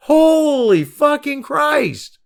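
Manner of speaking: high, loud, very slow exclamation; shouted disbelief. Real, not acted. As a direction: cry it out in disbelief. Repeats, more incredulous.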